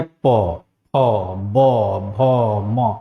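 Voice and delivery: each labial consonant said slowly and separately; प फ ब भ म, each with the lips together and a pause; articulated labial consonants. Shown as a demonstration. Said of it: "जैसे हम बोलते हैं". (हर व्यंजन ओठ से अलग-अलग, धीरे बोलें)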